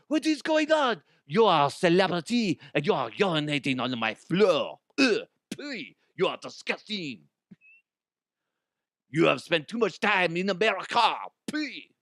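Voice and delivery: indignant put-on French accent, high on the opener; mock French shopkeeper. French shop owner scolding Elton, in mock French outrage.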